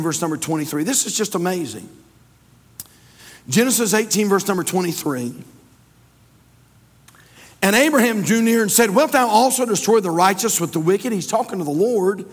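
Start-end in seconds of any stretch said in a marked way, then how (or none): 3.5-11.1 loud, high preacher's reading; impassioned scripture reading. Acted. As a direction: ring the verse out over the congregation. Reads scripture aloud to congregation.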